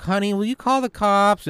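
high-pitched suburban spouse calling out; mock worried neighbour. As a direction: pitch it way up, imitating an alarmed neighbour. Voices an alarmed suburban neighbour.

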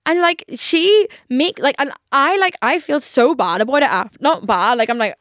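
ordinary delivery

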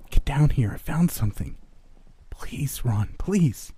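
put-on high, hushed child voice; pleading little brother. A narrator's reading. Little brother urging Ron under the bed, pleading. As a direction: beg urgently, breaking into a whisper.

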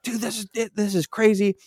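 high-pitched mock panic; retold freaking out. Player to buddy on voice chat, mock freaking out. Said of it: "And I'm freaking out".

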